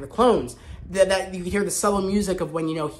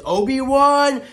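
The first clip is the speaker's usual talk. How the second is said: Vader's anguished shout of the name, higher and louder; angry call; 7 semitones higher, 6 dB louder, much slower than usual. Retold or imitated. Vader calling after the departing Obi-Wan, loud and anguished. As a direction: bellow the name after him like a wounded Vader.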